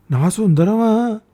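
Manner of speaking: high, slow delighted recognition; sudden happy realisation; surprised joy. Acted. (తల్లి ఆశ్చర్యంగా, సంతోషంగా)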